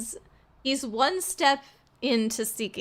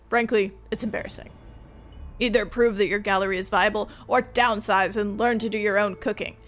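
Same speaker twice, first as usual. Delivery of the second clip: stern father; 3 dB louder than usual. Acted. Father's letter refusing funding.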